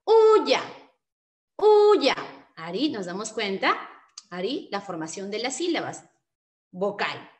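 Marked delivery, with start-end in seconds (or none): 0.0-2.1 UYA high and loud, U held, then dropping on YA; syllable demonstration. Shown as a demonstration. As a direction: show the syllables U-YA for learners.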